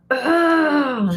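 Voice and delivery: high, drawn-out "ugh" of distaste; a mock disgusted ugh; disgusted groan. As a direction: groan like the air feels heavy. Bad energy after leaving.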